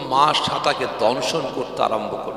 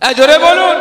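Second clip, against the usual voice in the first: calling out; 13 semitones higher, 10 dB louder than usual. Real, not acted. Urging crowd to respond.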